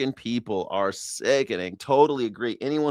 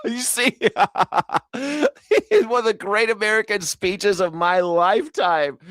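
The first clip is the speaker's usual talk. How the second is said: high-pitched, breaking up with laughter; laughing mockery; 9 semitones higher than usual. Host repeating the guest's gushing praise, laughing in mockery. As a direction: parrot the praise while cracking up.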